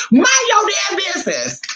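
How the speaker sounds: high shouted mom voice; mother shuts down her kid, high shout; mom snapping. Retold or imitated. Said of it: "I want my mom to say".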